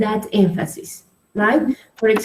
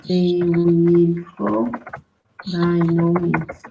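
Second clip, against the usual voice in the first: slow, deliberate example sentence; 4 semitones lower, slower than usual. Shown as a demonstration. Teacher shows the student where the phrase goes, slow and deliberate. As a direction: lay each word down slowly. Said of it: "for example".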